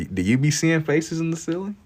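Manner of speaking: high-pitched mimicry, climbing to the end; mock questioning. Streamer echoing a viewer's suspicious question, high mock questioning. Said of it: "Questions like that".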